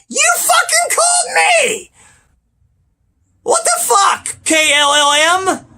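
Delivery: screaming it, voice cracking high; furious shout. Real, not acted. Trucker yelling at the recruiters who called him: furious cracked shout. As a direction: yell in disbelief at the caller.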